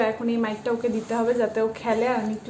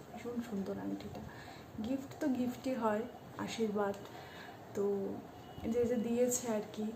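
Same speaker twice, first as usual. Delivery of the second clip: speaking softly; 16 dB quieter than usual. Real, not acted. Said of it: "আমি এই জন্য আস্তে আস্তে কথা বলছিলাম".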